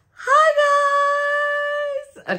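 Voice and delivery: high excited squeal of a greeting. Real, not acted. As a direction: squeal hi at the camera. Squealed hello to viewers.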